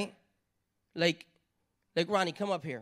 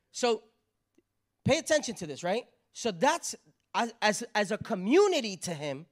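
ordinary delivery

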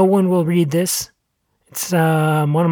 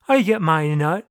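squirrel voice. A narrator's reading. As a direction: drop the pitch for the squirrel's reply. Squirrel replies in the quarrel.

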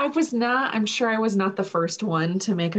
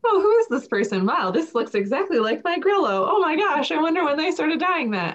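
surprised; 8 semitones higher than usual. Retold or imitated. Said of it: "Now it's like".

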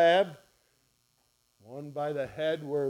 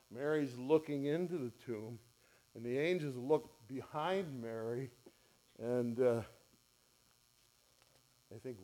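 ordinary talk

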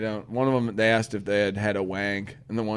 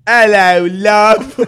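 high, loud mock Cockney greeting; hammy Cockney accent; 10 semitones higher, 14 dB louder, much slower than usual. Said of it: "Was my Cockney accent, you pig?".

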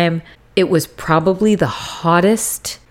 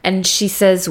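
ordinary talk, nothing marked

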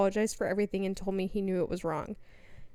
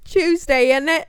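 loud, high Australian tease; hammed-up Aussie; mock Aussie accent; 7 semitones higher, 11 dB louder, slower than usual. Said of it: "Aussie bit continues".

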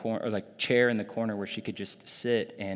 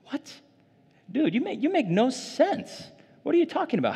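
much higher, mock-baffled voice; incredulous listener; 14 semitones higher than usual. Mocking the doubter who finds the psalm nonsense, incredulous. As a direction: play the skeptic who can't follow.

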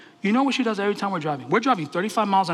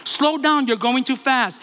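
raised, higher-pitched mock yell; retold screaming; 5 semitones higher, slower than usual. Mother complaining about speed from back seat, mock screaming. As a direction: imitate a nagging backseat driver yelling. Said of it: "screaming bloody murder".

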